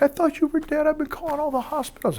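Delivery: high, thin mock crying voice of his wife; imitating crying wife. Wife crying on the phone to him, mock teary high voice. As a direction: lightly voice her weeping down the phone. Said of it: "She's crying".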